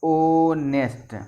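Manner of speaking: Honest drawn out very slowly, silent h; slow model word. Shown as a demonstration. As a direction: stretch out honest so students hear "onest". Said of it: "इसको कैसे लिखेंगे?".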